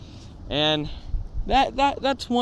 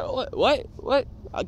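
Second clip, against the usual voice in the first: higher, puzzled repeated what; confused kid; 8 semitones higher, 3 dB quieter, much slower than usual. Retold or imitated. Little kid baffled by the teacher's yell, high confused. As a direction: sound lost and bewildered. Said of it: "I'm like".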